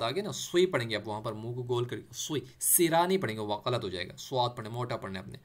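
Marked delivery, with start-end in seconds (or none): none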